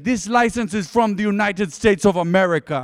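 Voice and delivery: mock a South African officer's indignation; South African accent. South African policewoman objects to an American license, indignant accent. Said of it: "I haven't really tried an African accent".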